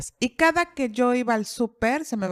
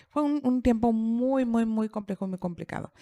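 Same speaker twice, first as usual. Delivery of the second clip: ordinary talk, nothing marked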